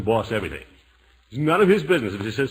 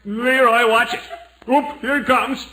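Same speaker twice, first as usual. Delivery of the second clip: loud, higher alarmed call; calling out to Leroy as the snowball flies, high and loud; warning shout; 10 semitones higher, 6 dB louder than usual. Acted. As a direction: yell a warning as the snowball comes.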